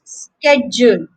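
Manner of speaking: slow SKED-jool; American "schedule", SKED-jool; American pronunciation. Shown as a demonstration. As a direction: stretch "schedule" with sk and a j sound. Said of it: "that's just the ja sound".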